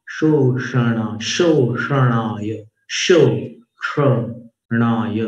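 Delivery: "शोषणाय" very slowly, palatal श then retroflex ष; "शोषणाय" drawn out syllable by syllable. Shown as a demonstration. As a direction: stretch out "शोषणाय" to show both sh sounds. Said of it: "जीभ नीचे लेके तालु पे श".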